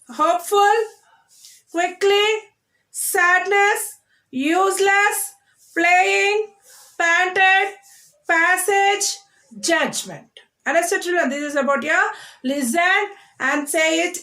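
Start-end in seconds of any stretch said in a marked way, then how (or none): 0.1-10.3 high, measured word drill from "Hopeful" to "Judgment", first syllables stressed. Shown as a demonstration. Teacher drills children on two-syllable words, stressing first syllables. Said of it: "we are stressing the first syllable".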